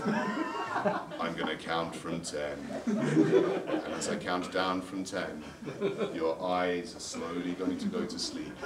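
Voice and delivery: very slow, low countdown voice; mock hypnotist. Prankster counting a caller down to sleep, slow and low. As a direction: draw every word out low, as if inducing a trance.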